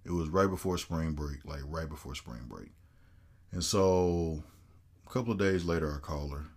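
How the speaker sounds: ordinary delivery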